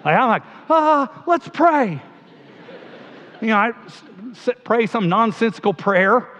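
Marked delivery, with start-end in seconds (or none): 0.7-2.0 high, loud, awkward outburst; comic retold panic, pitched way up; flustered. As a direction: blurt it out as if at a total loss. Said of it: "I don't know what to do with that".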